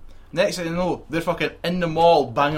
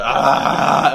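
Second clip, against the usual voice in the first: startled yell; 8 dB louder than usual. Retold or imitated. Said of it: "I was like".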